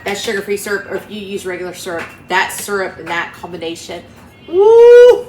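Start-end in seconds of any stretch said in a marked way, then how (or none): 4.5-5.3 high, loud delighted whoop; excited whoop. Real, not acted. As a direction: whoop with joy at the taste. Delighted by syrup combination.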